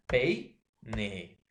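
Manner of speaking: slow, deliberate "peine" at the end; slow teaching. Shown as a demonstration. Teacher dividing "peine" for the class, slow and deliberate. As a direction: lay out "será peine" slowly for students. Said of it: "E es una vocal abierta".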